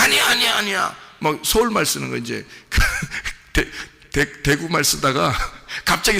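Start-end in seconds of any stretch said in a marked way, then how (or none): 0.0-1.0 panicked denial. Retold or imitated. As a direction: deny it in a flustered rush. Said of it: "그러니까 베드로가".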